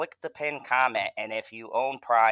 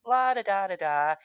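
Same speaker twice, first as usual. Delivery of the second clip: lightly voiced mock la-da-da, higher; mocking sing-song; 9 semitones higher than usual. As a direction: tease him with a smug little tune. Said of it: "this son of a bitch is just like".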